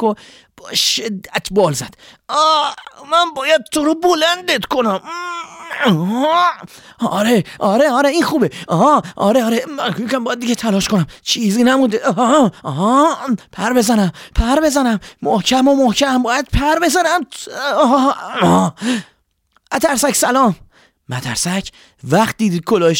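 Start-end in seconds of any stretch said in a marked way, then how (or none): 2.3-18.7 a tiny bird heaving with all its might; straining swallow. Acted. Swallow lifting the snowy hat, high straining voice. 19.7-20.6 high, louder bird voice calling hello; a bird sings out a greeting from above; cheerful swallow greeting. Acted. Swallow flying with the hat, high calling greeting.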